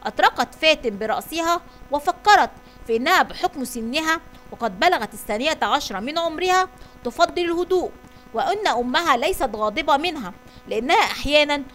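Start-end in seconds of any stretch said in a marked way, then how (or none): none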